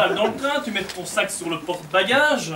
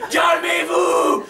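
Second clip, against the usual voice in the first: slow, higher stretched-out shout; yelling leader; 8 semitones higher, much slower than usual. Acted. Scout leader yelling at the rowdy kids to calm down.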